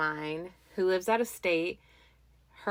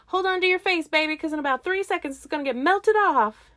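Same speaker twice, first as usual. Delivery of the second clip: high, loud mock warning, building to a big finish; finto avvertimento esaltato; 10 semitones higher, 6 dB louder, faster than usual. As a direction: hype up a rider in a teasing high voice. Mock hype quote to rider.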